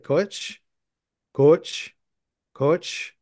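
"Coach" as C-O plus "which", run together fast; "coach" with a W glide, rising each time; Minnesota accent. Shown as a demonstration. Teaching friends the accent, "coach" nearly two syllables, Minnesota-style. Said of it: "but say it really fast".

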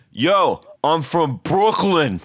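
slow, drawn-out Brooklyn guy; imitating concert dude. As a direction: drag it out like a street guy. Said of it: "And he goes".

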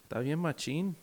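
hammed-up Mexican Spanish accent. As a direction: imitate a Mexican fan talking. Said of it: "Mexicans with accents say Hulk".

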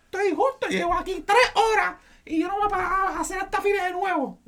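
high, loud laughing tantrum; mock yelling tantrum. Customer throwing a fit at the office, high, loud mock tantrum.

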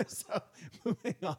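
high, giggly words; real embarrassed laugh through the words. Real, not acted. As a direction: laugh while trying to change the subject. Laughing off the accent teasing.

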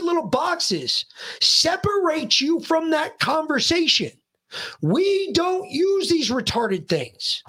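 high-pitched, emphatic retold sermon to a child; yelling tirade. Dad tells son to carry on, yelling tirade. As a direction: re-enact a heated rant, hammering each phrase. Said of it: "after he was done yelling".

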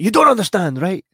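loudly explaining the obvious; snapping back at his wife over the accent; exasperated retort. Retold or imitated. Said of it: "I'm like".

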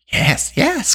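high, excited creature voice; keen creature squeak; Gurgi crying eagerly. A narrator's reading. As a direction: yelp it eagerly as a hungry little beast. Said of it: "Gurgi cried".

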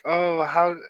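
a hint of a kid asking a question; lightly voiced little kid. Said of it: "one kid was like".

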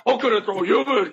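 high, dumb brute threat; simple-minded monster insisting; Hulk voice. Acted. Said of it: "the best Hulk and Thor argument".

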